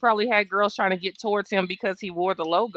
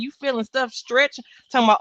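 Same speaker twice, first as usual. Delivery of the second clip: ordinary delivery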